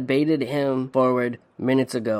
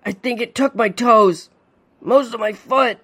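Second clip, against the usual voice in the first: high, strained words through a clenched jaw; pained through clenched jaw; 8 semitones higher, 3 dB louder than usual. A narrator's reading. Father bitten by a fish, pained through clenched jaw. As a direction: grit it out in pain. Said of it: "through a clenched jaw".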